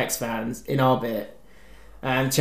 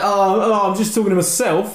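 mock loud fan; 5 semitones higher than usual. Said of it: "shout talking".

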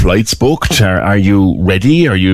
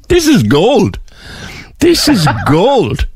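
laughing through the words, high and slow; laughing delight; 9 semitones higher, slower than usual. Real, not acted. Host delighted by the guest's pool story, laughing. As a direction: chuckle it out, delighted.

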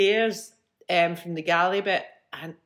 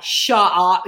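loud, punched mock astonishment; acted disbelief; 8 dB louder than usual. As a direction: exclaim it like a stunned friend. Friend's disbelief at the news, acted.

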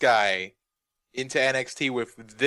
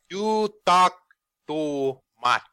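Nakamura impression; much slower than usual. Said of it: "listening to him delivering lines".

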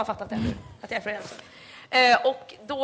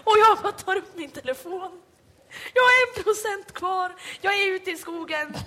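higher, louder, building drama; heightened telling; 8 semitones higher, 4 dB louder than usual. Real, not acted. Telling friends her misery, higher and louder. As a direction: pitch it up like a tragic film.

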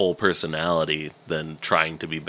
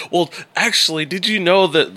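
high, loud well-actually voice; know-it-all; 11 semitones higher, 4 dB louder than usual. Retold or imitated. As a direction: play the smug know-it-all. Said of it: "can't with anybody".

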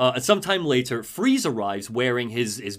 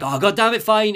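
exasperated shout; 8 semitones higher, 6 dB louder than usual. Retold or imitated. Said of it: "Freeze's like".

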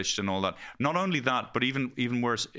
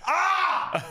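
ecstatic high shout; 4 dB louder, much slower than usual. Acted. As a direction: yell like jumping into icy sea. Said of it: "Sorry, I'm shouting too much".

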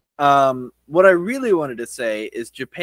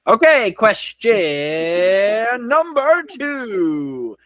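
slow, big game-show energy; hyped quiz host; 8 semitones higher, 5 dB louder, much slower than usual. Shown as a demonstration. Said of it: "You need to have this kind of energy".

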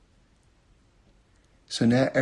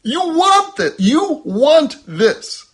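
much higher and louder, pleading and urging; frustrated coaxing; 12 semitones higher, 8 dB louder than usual. Retold or imitated. Frustrated parent coaxing a baby to eat, high pleading. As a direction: coax a stubborn baby, exasperated. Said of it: "I'm like".

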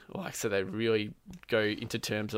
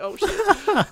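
very high, laughing exclamation; mock alarm; 8 dB louder than usual. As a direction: squeal it in comic alarm through a laugh. Retold startled reply to running medic.

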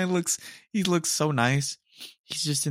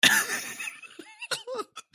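high wheezing laugh falling away; 3 dB quieter than usual. Real, not acted. Laughing at the video's caption, a high wheezing laugh. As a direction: laugh until you run out of air. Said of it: "I'm dying".

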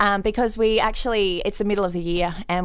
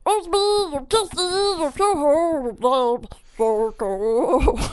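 mock spooky voice; 5 semitones higher, much slower than usual.

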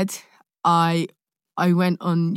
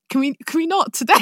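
pleading ask; 9 semitones higher, faster than usual. Retold or imitated. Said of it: "and I was like".

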